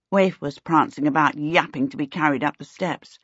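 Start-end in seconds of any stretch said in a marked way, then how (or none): none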